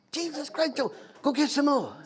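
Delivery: high, laughing mock exasperation; exasperated. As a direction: throw up your hands at Joe. Exasperated retort to Joe.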